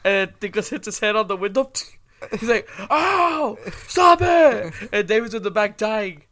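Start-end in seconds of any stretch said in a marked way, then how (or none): 2.9-4.7 high, loud yelp of protest; pained mock shout. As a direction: cry out like a kid who got bumped. Retold boy hurt in the car.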